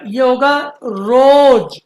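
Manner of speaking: रोज drawn out slowly with a long o; slow emphatic रोज; correct reading. Shown as a demonstration. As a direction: stretch रोज as rooz. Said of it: "ज नहीं होगा".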